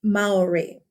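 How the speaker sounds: Maori said as "MOW-ree", falling; correct pronunciation. Shown as a demonstration. Teacher repeating the correct form, "Maori" as "MOW-ree". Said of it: "I hear people say Maori all the time".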